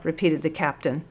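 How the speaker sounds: plain reading voice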